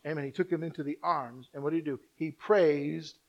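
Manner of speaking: ordinary talk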